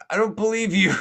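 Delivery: high, loud acted accusation; yelled distrust. Man in pond distrusts officer, yelling back. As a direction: holler disbelief across the water.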